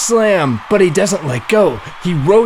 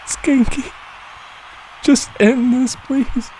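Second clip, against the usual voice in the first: pleading; 9 semitones higher than usual. Acted. Voicing beaten Chad pleading.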